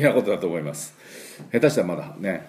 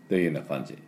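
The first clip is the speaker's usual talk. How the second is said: normal talking voice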